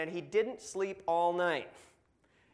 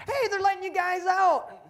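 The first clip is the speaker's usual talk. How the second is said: much higher, louder, cheerful announcement; a freshly saved jailer delivering good news; happy jailer; 9 dB louder than usual. Acted. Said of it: "He's happy".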